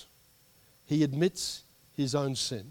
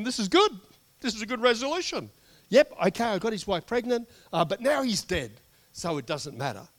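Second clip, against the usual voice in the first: higher, louder smug self-satisfaction; smug David; 8 semitones higher, 5 dB louder than usual. Retold or imitated. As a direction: gloat like a king who got away with it. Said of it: "So David's thinking".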